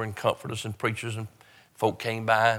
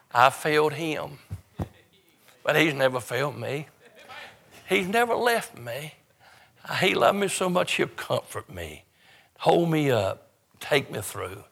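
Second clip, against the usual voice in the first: fervent preaching; 4 semitones higher than usual. Acted. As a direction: preach it out with fervor, voice climbing.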